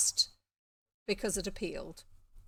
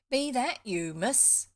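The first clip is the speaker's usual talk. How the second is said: put-on rural Somerset farm boy, higher and louder; slight Somerset accent; 4 semitones higher, 7 dB louder, slower than usual. Farm boy calls to the young miss at the door, slight Somerset accent. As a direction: call out with a light Somerset country accent. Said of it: "I think it's slightly Somerset".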